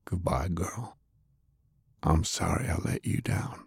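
whispered, grieving goodbye; tender whisper. A narrator's reading. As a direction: whisper a farewell to the dead. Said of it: "kissed her gently on the cheek".

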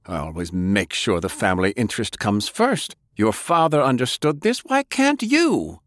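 defiant anger. Acted. Grandfather justifying himself to his grandson, heated. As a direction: defend yourself, rising to a cry.

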